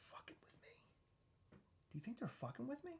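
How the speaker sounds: hushed, conspiratorial whisper; suspicious whisper. Acted. Host suspecting the toy company's revenge, conspiratorial whisper. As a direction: drop to a whisper, as if uncovering a plot.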